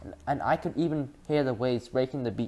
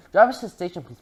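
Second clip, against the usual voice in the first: prim; 5 semitones higher, 7 dB louder than usual. A narrator's reading. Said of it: "looking prim".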